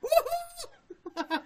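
laughing woohoo. Real, not acted. Celebrating his own punchline.

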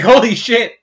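high, loud alarmed outburst; startled by a late-night text; shocked exclamation. Retold or imitated. Reading a family text at night, loud shocked exclamation. Reacting to 2am message.

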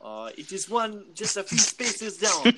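a vague Croatian-sounding lilt, drawn out; game master slipping into a strange Croatian-like accent; odd Croatian-ish accent. Acted. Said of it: "very non-Wakandan and very something I don't recognize".

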